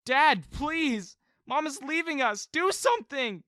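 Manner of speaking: high, loud desperate begging; loud pleading. A narrator's reading. Son begging his dad to stop mom, loud plea. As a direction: beg him at the top of your voice. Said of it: "I pleaded with him".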